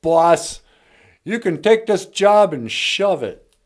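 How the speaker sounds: loud, high mock yell at the boss; mock tell-off. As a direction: shout it like quitting in a huff. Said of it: "and you go".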